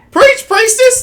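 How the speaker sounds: very high, loud church-style shout; rousing cheer. Real, not acted. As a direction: egg her on like a fired-up congregation. Cheers on the other's preaching.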